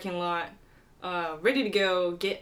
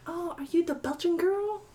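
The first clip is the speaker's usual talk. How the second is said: imitating a French player, much higher, in a French accent; 10 semitones higher than usual. French accent, a French golfer calling from her car window. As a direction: put on a French accent for the stranger. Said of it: "In a French accent".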